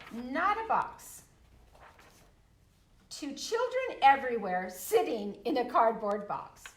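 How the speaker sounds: loud animated read-aloud, two voices; reading a picture book to a group, animated; reading to children. Acted. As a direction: read the questions and the answers boldly.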